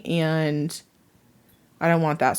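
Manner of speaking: ordinary talk, nothing marked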